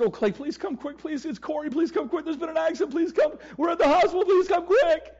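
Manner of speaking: high, rising panicked begging; a mother calling in desperation from the hospital; frantic mother. Retold or imitated. Wife on the answering machine begging Clay to come, high frantic voice. Wife's frantic message after accident.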